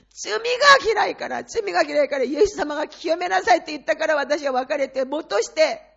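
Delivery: 少し大きく高めに、泣き訴える祈り; crying out to God. Retold or imitated. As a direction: retell a tearful cry to God on the roadside. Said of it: "泣き叫びました". (道端で娘のために神に泣き叫ぶ)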